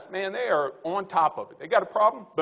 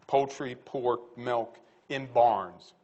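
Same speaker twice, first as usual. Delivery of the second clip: very slow, lower, spaced-out list; 6 semitones lower, much slower than usual. Real, not acted. Speaker to audience, slowly spelling out his point. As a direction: lay each word down deliberately. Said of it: "slowed listing of the same items".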